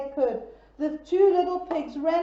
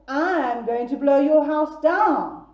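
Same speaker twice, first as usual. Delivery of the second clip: slow, louder menacing wolf; storybook wolf threatening the piggy; big bad wolf threat; 7 dB louder, slower than usual. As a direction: growl the threat out slowly. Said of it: "said the bad wolf".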